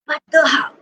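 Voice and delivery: plain reading voice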